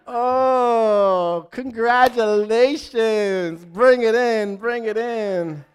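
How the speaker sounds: high, slow, syrupy fake cheer; mock-sweet congratulations to a promoted coworker. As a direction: gush insincerely at the coworker. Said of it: "And you go to your coworkers".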